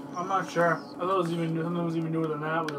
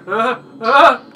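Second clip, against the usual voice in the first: imitated grunt; 6 semitones higher, 15 dB louder than usual. Imitating game character's grunts.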